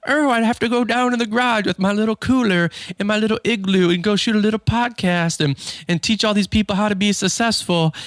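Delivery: high-pitched mimicking of a teasing wife; mocking whine. Wife teasing her husband about his podcast, mocking. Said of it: "starts making fun of me".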